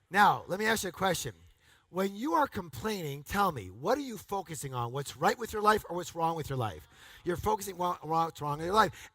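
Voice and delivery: normal talking voice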